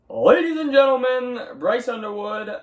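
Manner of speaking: a hint of a stadium announcer; mock announcer. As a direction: present him like a ring announcer. Announcing his quarterback.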